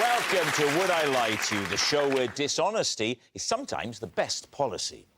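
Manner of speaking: upbeat TV host introduction; show host intro. Acted. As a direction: announce the guests with energy.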